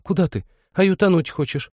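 put-on high, alarmed girl's cry; frightened. A narrator's reading. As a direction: plead in sudden fear.

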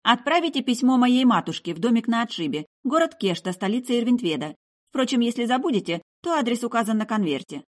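high, directing voice; young woman issuing orders, pitched high; bossy girl. Acted. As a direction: give instructions as if in charge. Girl orders commander to post letter.

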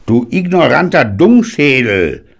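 high, loud contemptuous yap; old granddad yapping at a card player. A narrator's reading. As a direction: bark the insult with scorn. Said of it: "kläffte Granddaddy verächtlich".

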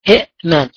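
إئمان with a glottal stop after the first i, i'-man; original form with two hamzas. Shown as a demonstration. Teacher showing the awkward original form to students, hamza stop clearly heard. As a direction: break إئمان with a hard hamza stop before mim. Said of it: "صعبة في النطق".

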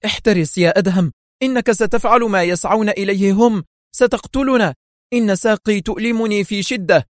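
high, panicky warning; alarmed cry. A narrator's reading. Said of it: "وصرخ وهي تعاود انطلاقها".

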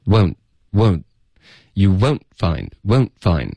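British "won't" with a relaxed, narrow glide; slow British demo with clean stops; British pronunciation. Shown as a demonstration. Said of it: "这是一个比较英式的发音".

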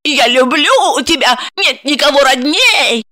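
high, slow mocking mimicry of her friend; high teasing mimicry of a friend's vow; mocking imitation. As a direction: parrot her friend's words back in a high sneer. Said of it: "передразнивала она подругу".

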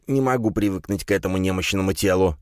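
usual speaking voice

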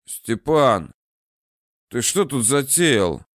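медленно, слабым старческим голосом; тихо спрашивает, прикидываясь немощным; слабый голос. Acted. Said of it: "тем же слабым голосом осведомился". (слабый старческий вопрос)

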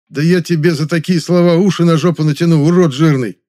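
loud, spitting furious threat; spat out angrily. A narrator's reading. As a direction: spit the words out in rage. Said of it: "зло сказал, словно выплюнул".

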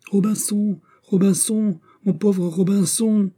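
very high wailing parrot voice, slowing at the end; parrot crying with all its might. A narrator's reading. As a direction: call desperately like an abandoned parrot. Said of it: "en criant de toutes ses forces".

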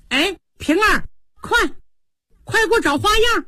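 high, hurried call from the doorway. Acted. Xifeng calls to her maid as she walks in, high hurried call. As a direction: shout ahead as she rushes in. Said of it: "一边走一边喊".

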